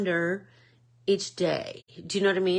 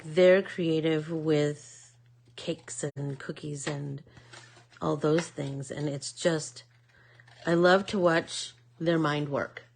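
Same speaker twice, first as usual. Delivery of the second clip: normal talking voice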